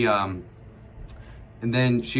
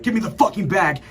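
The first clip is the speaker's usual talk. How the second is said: retold angry yelling; 8 semitones higher than usual. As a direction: bellow it like a huge angry dad. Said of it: "he starts yelling at me".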